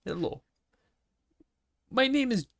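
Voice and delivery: high-pitched mock Russian accent. Co-host introducing an American name, mock Russian accent. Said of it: "could say in a Russian accent".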